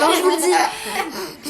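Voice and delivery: mother laughing at the chaos. Real, not acted. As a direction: laugh it off to the viewers. Live stream going off the rails.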